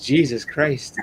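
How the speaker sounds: a lightly voiced stunned sister; quick retold gasp of disbelief; imitating his sister at normal pace. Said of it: "she just goes".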